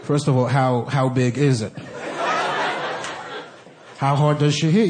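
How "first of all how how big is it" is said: normal talking voice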